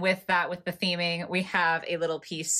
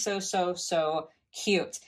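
very slow, stretched-out gush; drawn-out adoring; much slower than usual. Real, not acted. Cooing to viewers over the tiny board, slow and stretched. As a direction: savour each so. Adoring the pet shop set.

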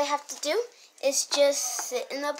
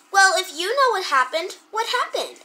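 ordinary talk, nothing marked

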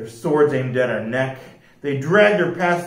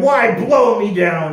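loud, higher amazed captain; raised-voice pirate astonishment; astonished cry; 7 semitones higher, 6 dB louder than usual. A narrator's reading. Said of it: "The captain cried".

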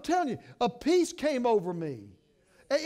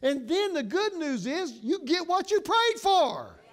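higher, louder preaching build; preaching at full force; 6 semitones higher, 3 dB louder than usual. Acted. Preacher promising the congregation answered prayer, full voice. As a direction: lift it up and preach.

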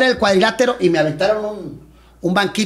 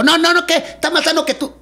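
stubborn father; 9 semitones higher than usual. Retold or imitated. Father brushes off job application.

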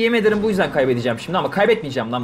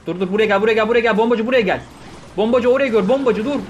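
higher, louder repeated commands; urgent calling out; 6 semitones higher, 3 dB louder than usual. Real, not acted. Gamer shouting commands at his bomber, urgent. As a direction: call the unit over, urgently.

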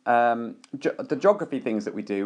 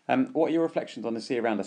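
ordinary talk, nothing marked

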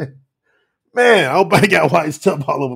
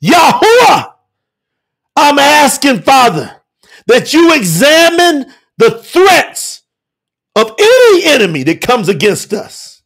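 fervent prayer; 3 semitones higher, 5 dB louder than usual. Real, not acted. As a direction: call out to God in waves, phrase by phrase.

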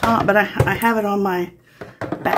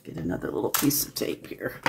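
whispering; 9 semitones lower, 11 dB quieter than usual. Real, not acted. Crafter muttering to herself.

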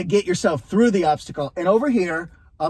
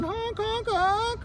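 high honking goose noises; goose honk imitation; 4 dB quieter, much slower than usual. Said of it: "Worst Pauly Shore impression ever".

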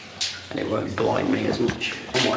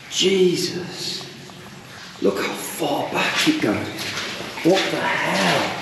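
slow, higher exclamations of amazement; marvel, drawing the words right out; stunned awe; 4 semitones higher, slower than usual. Real, not acted. Explorer seeing how far back it goes, stunned.

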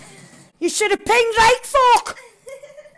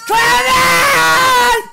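loud, higher shout; will the car on; urging shout; 4 semitones higher, 4 dB louder than usual. Real, not acted. Gamer urging his car, loud high shout.

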